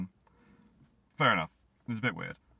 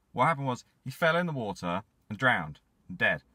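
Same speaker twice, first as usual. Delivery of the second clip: ordinary delivery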